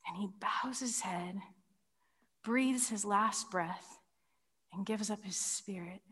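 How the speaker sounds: solemn hush over the death; hushed narration. Real, not acted. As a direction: let the voice sink with the death.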